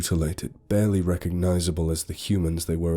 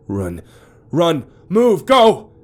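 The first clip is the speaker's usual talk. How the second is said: frantic roar, much higher and louder; 12 semitones higher, 10 dB louder, much slower than usual. Acted. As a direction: bellow it in panic. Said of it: "Dave suddenly roared".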